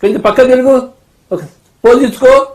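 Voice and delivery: hammed-up seer posing a client, rising to mock awe; confident mystic act. Shown as a demonstration. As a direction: fake guru reading a client's past lives, growing into mock amazement. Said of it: "అది కాన్ఫిడెంట్గా చెప్పాలి".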